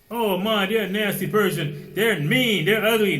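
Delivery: high, loud complaining to mom; mock a child tattling name-calling; whining child. Voicing complaining child to mother.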